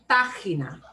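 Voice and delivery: Página as "PAH-khee-nah", PA punched; stress on PA. Shown as a demonstration. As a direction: hit the first syllable of Página. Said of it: "means we punch that more".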